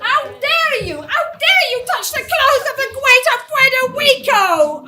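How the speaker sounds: furious outraged shout; loud, indignant and furious; shaking with anger. Acted.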